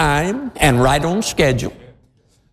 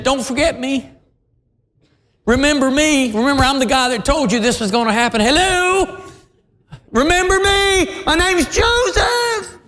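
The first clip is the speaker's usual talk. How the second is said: rising higher and higher, calling after him; pleading Joseph; 9 semitones higher than usual. Acted. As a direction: beg not to be forgotten, voice climbing up. Joseph pleading as butler leaves.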